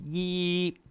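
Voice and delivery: YI held high and level; high, steady YI; first tone. Shown as a demonstration. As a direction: sustain YI on a high even pitch. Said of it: "first tone".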